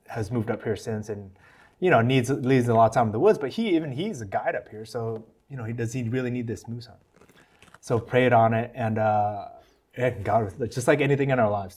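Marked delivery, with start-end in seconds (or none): none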